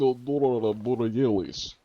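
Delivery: comic Russian voice with heavy r sounds; Russian accent. Pretend. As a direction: ham it up in a drawn-out Russian accent. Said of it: "started going Russian for that".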